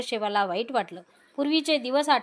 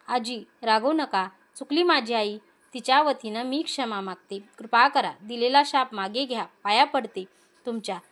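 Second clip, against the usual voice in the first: ordinary delivery, nothing marked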